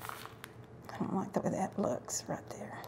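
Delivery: whispered aside. Real, not acted. Crafter whispering to herself about a messy spot, quiet. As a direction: whisper it, half to yourself, unhappy with it.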